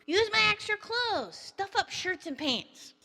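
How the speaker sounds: high, lightly voiced child; high kid voice, slowing down; eager little son. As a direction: pipe up with a suggestion like a kid. Plays the fake son instructing.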